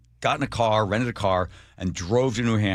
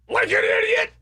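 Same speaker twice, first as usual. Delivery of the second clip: shrill mock quote, very high and loud; mocking squeal; 5 dB louder than usual. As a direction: squeak the insult.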